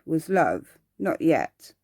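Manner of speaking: ordinary delivery, nothing marked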